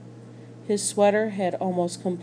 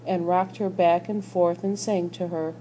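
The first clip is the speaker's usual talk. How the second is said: usual speaking voice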